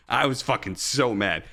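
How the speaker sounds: ordinary delivery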